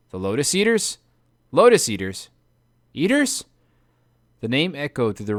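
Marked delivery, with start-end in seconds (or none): 0.1-3.4 rising higher each time in alarm; disbelieving, climbing fear; alarmed echo. Acted. Polites to Odysseus, echoing the name in alarm.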